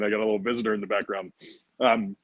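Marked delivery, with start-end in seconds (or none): none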